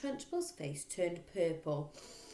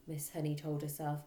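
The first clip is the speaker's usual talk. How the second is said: plain reading voice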